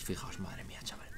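barely audible whisper; awed whisper. Real, not acted. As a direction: whisper it under your breath in awe. Awed at the spreading fire.

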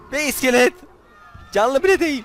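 loud, high disbelief; amazed exclaim. Real, not acted. Gamer marvels his T-Rex is a skeleton, loud and high. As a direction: cry out in gleeful amazement.

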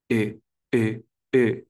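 "E" said with the mouth set for an i; short "E", squeezed narrow toward i; closed-mouth E. Shown as a demonstration. Teacher demonstrates an "E" with a closed mouth. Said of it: "Es una E con boca cerrada".

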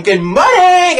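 shrill high mock scream; screaming good morning. Teacher bursts in at eleven, screaming a greeting. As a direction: yell it like an overexcited teacher. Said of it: "Starts screaming".

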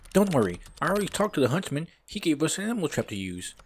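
high-pitched reassuring sidekick; high put-on game character voice; Bob. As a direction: pitch it up, telling a friend it's handled.

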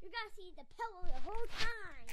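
loud, excited call over the pillow fight; excited shout. Real, not acted. Kid shouting to the camera during a pillow fight. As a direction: yell it out mid pillow fight.

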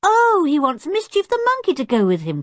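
high, excited exclamation; crying out. A narrator's reading. George sees what the chimpanzee wants, crying out. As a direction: exclaim it with sudden delight. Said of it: "cried George".

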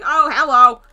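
sudden loud startled hello. Real, not acted. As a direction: jump and blurt the hello out loud. Startled greeting to a monster.

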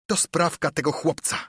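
high, vengeful outburst; villain's frustrated cry; crying out in anger. A narrator's reading. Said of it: "krzyczał ślepy żebrak Piu".

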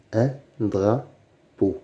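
"un drapeau" slowly, a pause between syllables. Shown as a demonstration. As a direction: sound out "drapeau" in separate beats. Teacher splits word into syllables. (« un drapeau » lentement, syllabe par syllabe)

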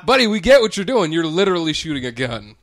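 loud, high, exasperated comic rant; retold outrage. Witness addressing the shooter as buddy, exasperated protest. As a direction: tell the man off in mock disbelief. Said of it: "And I'm like".

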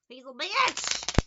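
slow, loud He's a bitch with a laugh; drawn-out yell. Shown as a demonstration. Laughing at the Leo, slow loud exclamation. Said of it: "Do you say it like that?".

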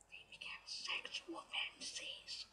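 slow, hushed stage whisper; confiding whisper. Real, not acted. Fan confessing teenage fantasies to the listener, slow whisper. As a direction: whisper it like a naughty secret.

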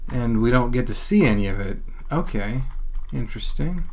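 usual speaking voice

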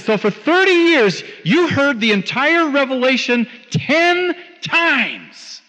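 high, drawn-out TEN TIMES; emphatic point-making. Retold or imitated. Preacher confronting the woman with thirty years of readings, emphatically. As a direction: hammer it home, stretching "ten times" slowly. Drives the point home to her.

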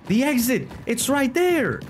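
high thrilled call; excited high shout. Real, not acted. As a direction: point and shout with relief. Spotting the exit.

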